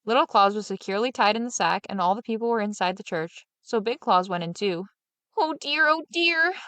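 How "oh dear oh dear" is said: sighing. A narrator's reading. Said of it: "Little Claus sighed in the sack".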